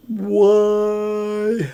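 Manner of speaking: high, long wailed word; mock sobbing moan; imitated crying. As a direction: wail it out like a man breaking down. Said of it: "he started crying".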